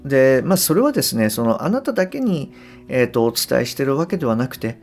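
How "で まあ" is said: normal talking voice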